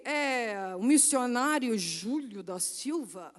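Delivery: slow, low imitation of a man; Júlio. Missionary introducing himself by phone, slow deep voice. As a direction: introduce yourself in a deep, drawn-out voice. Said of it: "Eu não sei imitar a voz dele".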